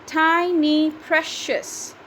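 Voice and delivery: "Tiny precious" slow and high, in four beats; syllable by syllable. Shown as a demonstration. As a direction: separate each syllable of "Tiny precious" for counting. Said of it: "again, two syllables".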